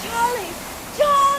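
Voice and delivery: high, frightened cries of his name; rising panic, screaming for Charlie; desperate scream. Acted. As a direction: scream for him as the boat goes down. Thrown into the water.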